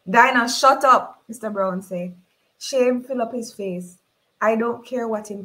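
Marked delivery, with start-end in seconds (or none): 0.1-1.2 loud sharp rebuke; angry. Acted. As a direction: cut her off angrily. Mister Brown snapping.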